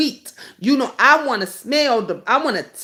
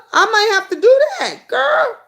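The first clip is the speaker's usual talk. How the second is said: much higher, louder, with a teary squeal; fat babe voice; 13 semitones higher, 5 dB louder than usual. Pretend. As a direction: play the sassy fat babe, crying out. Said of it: "in my fat babe voice".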